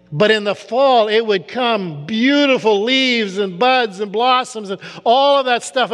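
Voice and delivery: rising preacher's fervor; preaching at full force. Acted.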